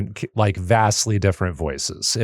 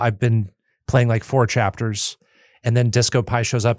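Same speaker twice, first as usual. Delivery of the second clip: ordinary delivery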